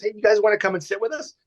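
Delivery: quarterback invitation. Retold or imitated. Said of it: "He goes".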